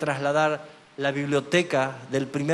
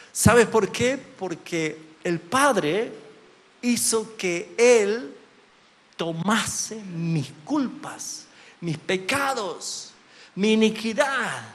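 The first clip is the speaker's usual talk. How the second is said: rising higher and louder, slow and emphatic; building sermon climax; 7 semitones higher, 6 dB louder than usual. Acted. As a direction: preach it up to a booming peak.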